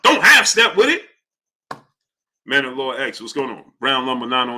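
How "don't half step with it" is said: higher, louder, stern movie-principal command; Lean On Me principal. Retold or imitated. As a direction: bark it at the students like a strict principal. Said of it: "How you say it on ... Lean On Me?".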